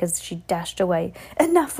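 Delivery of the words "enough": high, louder snap of Enough; sharp command. A narrator's reading. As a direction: cut them off sharply. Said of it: "Winifred said, catching her breath".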